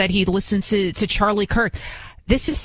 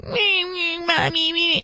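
high whiny fake sob of a little boy; imitating a boy crying for mommy; mock crying child; 9 semitones higher, slower than usual. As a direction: whimper it like a spoiled kid crying. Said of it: "This little boy was crying".

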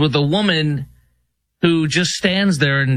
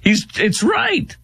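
amused delight; 11 semitones higher than usual. Real, not acted. Still cracking up after clip.

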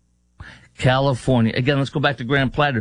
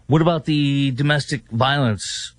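ordinary talk

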